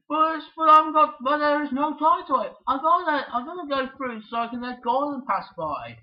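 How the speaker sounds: a hint of a flustered engine; retelling a character. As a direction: kid voicing the engine in a retold cartoon. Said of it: "and you say".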